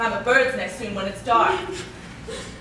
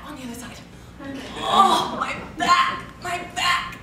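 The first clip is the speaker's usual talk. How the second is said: aching complaint; 3 semitones higher than usual. Acted. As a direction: groan through the aches. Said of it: "how my head aches".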